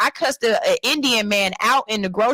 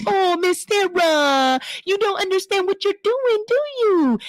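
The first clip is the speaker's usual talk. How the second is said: put-on condescending baby talk; 9 semitones higher than usual. Mocking how adults talk down to babies, high sing-song. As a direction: coo at her like she's a toddler. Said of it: "I be like".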